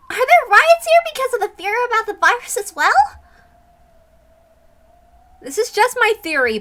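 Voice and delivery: put-on girl character voice. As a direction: lift into a worried young girl's voice.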